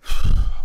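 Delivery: a long loud breath out, letting go; deep release sigh. Shown as a demonstration. Showing the listener how to breathe out, a deep release sigh. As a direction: exhale the tension audibly. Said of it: "take a deep breath".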